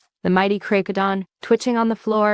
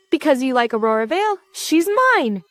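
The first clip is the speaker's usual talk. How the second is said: shouting back; 7 semitones higher than usual. A narrator's reading. Completely serious monster answers the hero, shouting back. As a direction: shout back in earnest, pitch raised. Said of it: "shouts back".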